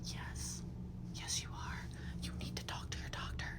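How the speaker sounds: hushed whisper; whispering a diagnosis to a tearful patient. Retold or imitated. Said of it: "I'm like".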